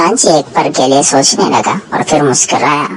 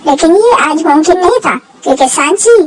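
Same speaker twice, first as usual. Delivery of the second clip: bright, high storyteller voice; story narration; 13 semitones higher than usual. A narrator's reading. Narrator closes the episode for young listeners, high lively telling. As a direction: tell the happy ending to children.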